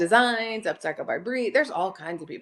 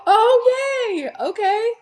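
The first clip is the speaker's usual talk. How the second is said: gasp, then a high, loud cheer; thrilled little yay; delighted gasp; 13 semitones higher, 7 dB louder, slower than usual. Real, not acted. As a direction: squeal with real delight. Delighted reaction to viewer comment.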